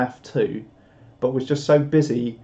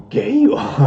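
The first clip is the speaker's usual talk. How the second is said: lightly voiced creature, higher and louder; a hint of a film-character voice, pitched up; Yoda; 8 semitones higher, 6 dB louder than usual. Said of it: "I just read that as Yoda".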